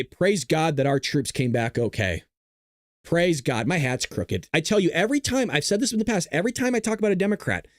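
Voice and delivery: ordinary delivery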